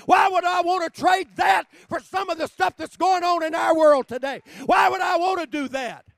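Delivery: high, very loud impassioned preaching; full-force preaching. Real, not acted. Preacher testifying that the burns disappeared, high full-force shout. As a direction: shout it out to the whole congregation.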